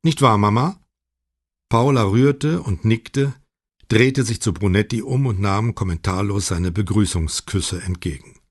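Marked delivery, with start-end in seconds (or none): none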